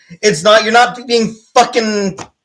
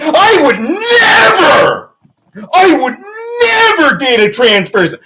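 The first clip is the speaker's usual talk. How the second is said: mock-cry the quote like a sulking bigot; mocking whiny voice; 5 semitones higher than usual. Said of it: "Where you're like".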